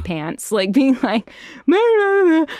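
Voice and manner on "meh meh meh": high loud mock yelling; mock actor shouting. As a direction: ham up a booth take, loud and high. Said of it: "screaming and shouting".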